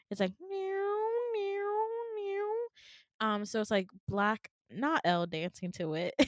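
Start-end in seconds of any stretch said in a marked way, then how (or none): 0.4-3.3 high wailing alarm noise; imitated alarm. Imitating the hospital alarm system going off, wailing. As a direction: wail it like a siren, high and steady. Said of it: "an alarm system going off".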